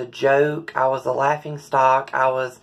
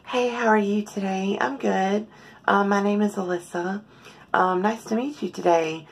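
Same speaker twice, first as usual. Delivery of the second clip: higher, soft, feminine-pitched greeting voice; soft public voice; 7 semitones higher than usual. Shown as a demonstration. Showing viewers her public voice, soft and high-pitched. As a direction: lift the voice up high and keep it soft and light. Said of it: "I speak in a very soft, high-pitched voice".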